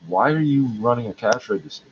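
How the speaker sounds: normal talking voice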